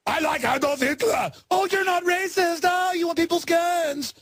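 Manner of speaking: very high, far-from-natural mock voice; mocking impersonation. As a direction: send up the quote in a high, stretched voice. Mocking double standard in Schwarzenegger voice.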